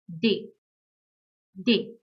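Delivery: de said as "deh"; German letter D. Shown as a demonstration. Teacher showing learners the German alphabet, D as "deh". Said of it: "Letter D is pronounced as".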